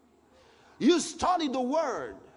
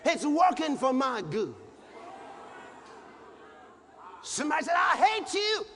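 preaching at full force; 6 semitones higher than usual. Acted. Preacher proclaiming scripture to the congregation, full force. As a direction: shout it out to the church.

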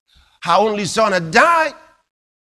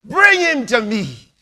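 loud, slow, higher "Bring"; commanding call; 5 semitones higher, 5 dB louder than usual. Retold or imitated. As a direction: call the man over with authority. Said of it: "He says".

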